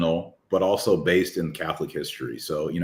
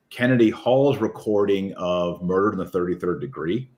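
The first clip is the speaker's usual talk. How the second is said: usual speaking voice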